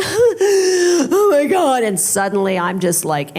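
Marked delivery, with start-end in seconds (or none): 0.0-1.8 slow, high, drawn-out panic; the dread sinks in; mock anxious moan, stretched high; anxious wail. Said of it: "anxiety queen".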